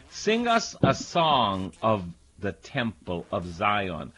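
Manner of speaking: slow, mournful scripture reading; psalm reading. Acted. Preacher reading the exiles' lament, slow and sorrowful. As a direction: read it like a lament, drawn out.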